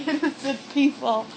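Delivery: usual speaking voice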